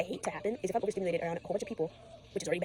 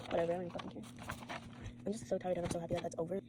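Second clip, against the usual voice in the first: ordinary talk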